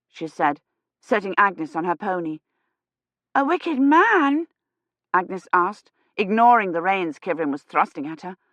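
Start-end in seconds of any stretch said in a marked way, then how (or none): 3.4-4.5 put-on child voice. A narrator's reading.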